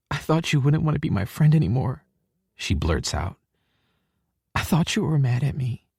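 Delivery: put-on upset girlfriend voice, fragile; upset young woman. A narrator's reading. Hallie figure, upset.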